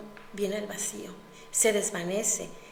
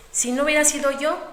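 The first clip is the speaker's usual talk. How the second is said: contemptuous; 5 semitones higher, 7 dB louder, faster than usual. Acted. Marido humilla a la esposa.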